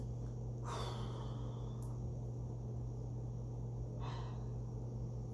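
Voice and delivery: drawn-out exasperated breath; heavy groaning sigh; long frustrated sigh. Real, not acted. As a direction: let out all the frustration. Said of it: "I'm very frustrated".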